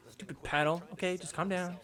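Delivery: softly grumbling to oneself; mock self-calming. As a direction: talk yourself down under your breath. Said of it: "pissed off and then immediately calm yourself".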